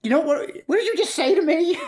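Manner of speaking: high-pitched indignant character voice; acted squeaky outburst; Pip. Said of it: "Pip's reaction to the whole".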